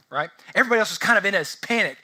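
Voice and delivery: normal talking voice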